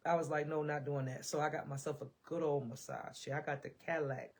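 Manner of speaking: normal talking voice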